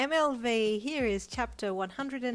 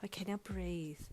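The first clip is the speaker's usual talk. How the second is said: soft calming whisper; 5 dB quieter than usual. Acted. Brian calming the bride before the walk, whispered. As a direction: breathe it to her gently.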